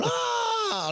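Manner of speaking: a short high mock scream of fright; retold scream. Woman at the mice circus shrieks as the mouse loses its tail, a high mock scream. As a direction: yelp "Ah!" high, imitating her shriek. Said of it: "she started screaming".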